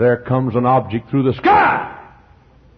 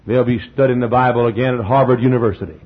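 ordinary talk, nothing marked

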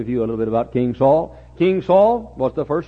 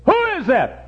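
high-pitched astonished question; startled fortune teller, high voice; amazed; 11 semitones higher than usual. Acted. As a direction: ask in acted amazement, pitch jumping up. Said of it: "she was amazed".

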